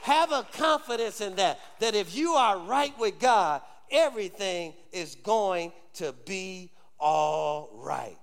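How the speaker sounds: high, driving sermon delivery; preaching. Real, not acted. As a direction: preach it out to the congregation, emphatic and insistent. Preaches at full force.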